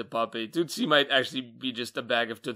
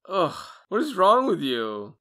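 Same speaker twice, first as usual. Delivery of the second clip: mock disgust; 6 semitones higher, 5 dB louder than usual. Real, not acted. Teasing disgust at co-host's habit.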